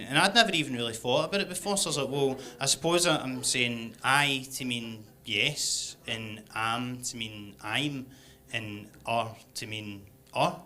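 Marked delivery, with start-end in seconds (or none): 2.1-10.6 slow, low and soft, working it out; hesitant explaining. Retold or imitated. Explaining to his English pal, hesitant, slow and low. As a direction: puzzle it through slowly, voice dropping away.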